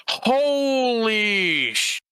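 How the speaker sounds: slow, drawn-out high gasp of awe; breathless wonder at the view; stunned awe. Acted. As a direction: let it hang, amazed. Awed at the view.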